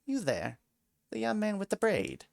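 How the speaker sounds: a lightly voiced tiny mouse; polite little creature calling out. A narrator's reading. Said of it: "a little voice rang out".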